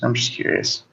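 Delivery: soft whispered repeat; whispering. Real, not acted. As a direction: whisper it coyly.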